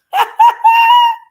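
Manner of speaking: sudden high loud cackle of disbelief; shrill burst of laughter. Real, not acted. Laughs at fifty memberships.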